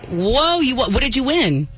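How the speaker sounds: high-pitched, impressed surprise; amazed reaction. Real, not acted. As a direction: burst in with wide-eyed amazement. Host reacts to game win story.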